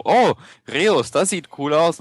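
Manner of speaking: retold delight at spotting something cool; impressed reaction. Guest recalls first seeing the game's name, impressed. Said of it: "Ich so".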